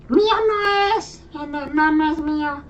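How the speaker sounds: voz aguda de niño chiquito; niños contestando. Retold or imitated. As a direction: imita a un niño que se defiende. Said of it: "todos los chavitos te contestan". (voz infantil aguda y rápida)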